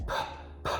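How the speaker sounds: bare P as a puff of air; voiceless burst of breath on P; aspirated P. Shown as a demonstration. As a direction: pop P with audible air. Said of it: "que por sinal também é aspirada".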